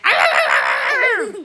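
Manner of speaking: loud, high mock dog snarl. Big dog snapping at the pug, snarling. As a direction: let out a big snarling growl. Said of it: "He snarled and snapped at me".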